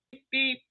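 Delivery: high, soft imitated horn beep; mock horn beep. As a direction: toot like a bus horn. Imitating bus on tour.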